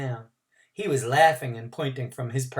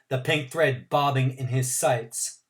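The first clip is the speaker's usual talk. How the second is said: ordinary delivery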